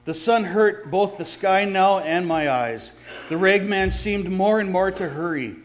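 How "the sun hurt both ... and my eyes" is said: ordinary delivery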